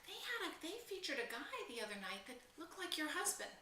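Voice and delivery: hint at the news like a nosy neighbor; a hint of a gossiping neighbor; gossipy old neighbor.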